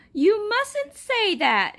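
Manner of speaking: put-on a boy defending his toy, high and earnest; earnest boy pleading. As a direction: plead like a hurt little boy. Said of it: "he said".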